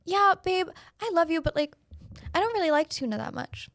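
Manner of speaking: high-pitched mock husband voice; imitating husband. Husband gently turning down wife's tuna, imitated high. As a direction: do a reluctant husband, pitched well up. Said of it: "my husband has the audacity to look at me and go".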